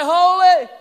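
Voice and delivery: a huge ringing shout of holy; awed cry. Shown as a demonstration. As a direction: cry it out in overwhelming awe. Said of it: "what happens when they cry".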